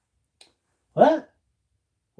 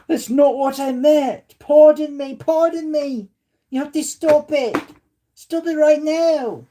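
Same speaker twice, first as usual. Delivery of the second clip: much higher, prim mock-scolding voice; put-on accent voice; 11 semitones higher, 3 dB louder than usual. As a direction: play a flustered lady telling them off. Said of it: "my very different accents I do".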